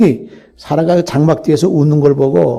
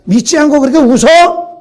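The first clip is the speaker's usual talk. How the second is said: higher, louder indignant rebuke; offended scolding; 7 semitones higher, 5 dB louder than usual. Pretend. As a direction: scold her as if personally insulted. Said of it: "괘씸한 마음으로". (괘씸해하며 높고 크게 꾸짖는 하나님)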